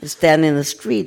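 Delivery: ordinary talk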